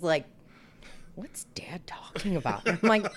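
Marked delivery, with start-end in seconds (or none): 1.2-2.7 put-on hushed puzzled kid; confused whisper. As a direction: whisper it, baffled. Retold child whisper.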